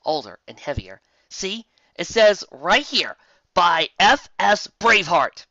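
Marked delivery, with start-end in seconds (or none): 1.3-5.4 louder, slow and emphatic; loud and deliberate; insistent Twilight. Acted. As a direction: spell it out, exasperated.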